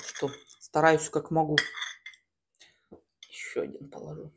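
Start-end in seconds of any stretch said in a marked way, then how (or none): 3.3-4.3 breathy whisper to herself. Real, not acted. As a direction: whisper it as a quick aside. Whispered aside while eating.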